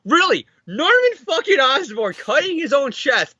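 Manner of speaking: high, incredulous outburst; exasperated disbelief at the friend. Real, not acted.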